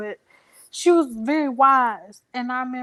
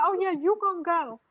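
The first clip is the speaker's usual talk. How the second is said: much higher, bossy and scolding; sassy mama; 7 semitones higher than usual. Retold or imitated. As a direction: mom ordering her daughter out. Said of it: "She's like".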